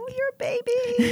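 squeaky high cooing voice, like fussing over an infant; doting coo. Acted. Host teasing her younger guest about her age, high doting coo. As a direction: coo it like you're talking to a baby.

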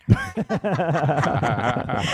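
slow breathy whisper. Retold or imitated. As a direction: whisper it slowly, like a secret. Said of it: "and he whispered".

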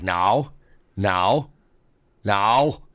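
building refusal to a dog; firm no to the dog. Retold or imitated. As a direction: tell the dog no, harder each time. Brother refusing dog, rising.

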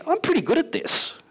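voiced high, pleased with himself; a self-satisfied inner voice; smug Paul. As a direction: play the proud apostle. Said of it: "do you not maybe somehow think".

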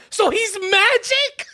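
put-on high, loud, drawn-out child's question; mock mind-blown. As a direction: marvel at it like an astounded kid. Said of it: "Their minds are blown".

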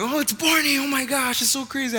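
high, gushing excitement; starstruck kid. Acted. Little kid seeing his Barney piñata, acting starstruck. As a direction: act out a little kid thrilled to meet his idol.

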